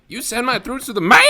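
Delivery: high squeaky voice breaking on mail; voice cracking. Retold or imitated. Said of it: "I just love the way he says mail".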